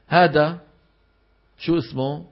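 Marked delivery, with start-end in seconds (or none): none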